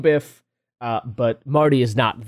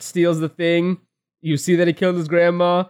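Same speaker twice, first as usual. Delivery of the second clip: ordinary delivery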